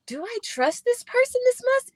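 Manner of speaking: high, wondering inner voice. Retold or imitated. Talking about a new friend, her high doubtful inner voice.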